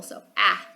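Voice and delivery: "ah" said as a short open A sound; teacher modelling the short vowel "ah". Shown as a demonstration. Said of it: "that's the short A".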